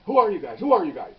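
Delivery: loud, insistent mimicked shouting; retold yelling. Suspect yelling at plainclothes cops the whole time, loud retold yell. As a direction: bark the question like the suspect. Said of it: "He's yelling the whole time".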